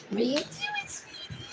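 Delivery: tiny, very high soft coo. Real, not acted. Talking sweetly to a pet, very high baby talk.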